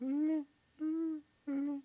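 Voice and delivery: high, indifferent little noises; imitated meh. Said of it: "Three of you are like".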